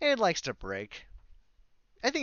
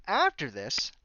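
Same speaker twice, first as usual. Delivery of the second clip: ordinary talk, nothing marked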